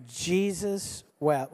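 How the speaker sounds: slow, drawn-out JESUS WEPT; leading recitation. Shown as a demonstration. Preacher leads congregation in the verse, slow recitation. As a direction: stretch each word so a crowd can join. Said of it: "just say it with me".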